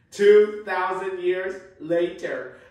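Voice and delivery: grand narrator retake, slowly; narrator title card. Shown as a demonstration. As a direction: boom it out like a cartoon time card. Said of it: "Let's try that again".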